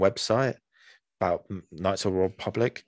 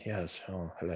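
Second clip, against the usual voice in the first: soft, refined British Jedi greeting; softly murmured posh hello; female Obi-Wan Kenobi; 10 dB quieter than usual. Retold or imitated. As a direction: lightly imitate a posh, polite Jedi. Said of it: "Obi-Wan Kenobi, but a female sort of".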